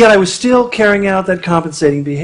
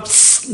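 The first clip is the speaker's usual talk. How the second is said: snake hiss; 8 dB quieter than usual. Retold or imitated. Snake's reply.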